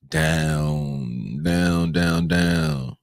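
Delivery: low, stretched-out repeated word, each sinking; sinking like a slow fall. Pretend. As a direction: playfully drag it down low. Dramatizing the market falling back down.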